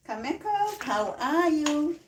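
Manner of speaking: high, slow sing-song hello; sing-song greeting. Real, not acted. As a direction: stretch the greeting out warmly. Live greeting viewers by name.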